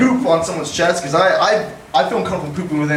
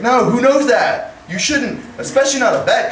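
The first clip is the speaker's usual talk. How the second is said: much higher, slowed outraged chiding; mock indignant scolding; 9 semitones higher than usual. Comic scolds mom's vet friend over her card, high mock outrage. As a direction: chide her like a scandalized aunt.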